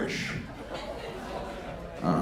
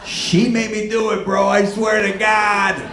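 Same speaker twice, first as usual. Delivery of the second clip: higher, louder insistent excuse; mock pleading shout; 9 semitones higher, 6 dB louder than usual. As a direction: swear it was her fault, loud.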